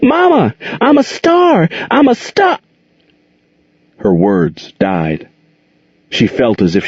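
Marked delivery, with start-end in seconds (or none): 0.0-2.6 put-on a little girl shouting her big news; excited calling. A narrator's reading. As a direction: call out thrilled to be home. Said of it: "calling with excitement".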